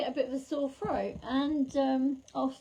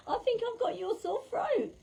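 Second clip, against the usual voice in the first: much higher, laughing disbelief; amused high voice; 12 semitones higher than usual. Real, not acted. Medium joking to the viewer about the sore throat, high and amused. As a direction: toss it up high in amused dismay. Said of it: "my voice is starting to feel all scratchy".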